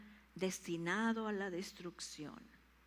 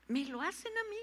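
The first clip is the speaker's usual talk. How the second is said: repeated higher and stronger; 7 semitones higher, faster than usual. Shown as a demonstration. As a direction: lift the line higher the second time. (repetido más agudo, con énfasis)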